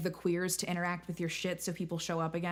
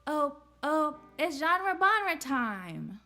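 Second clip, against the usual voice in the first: goblin voice; 9 semitones higher, much slower than usual. Acted. High put-on greeting voice.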